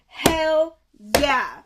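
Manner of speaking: loud, drawn-out celebration; happy cheering. Real, not acted. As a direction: cheer it loudly with satisfaction. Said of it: "I'm very happy about that".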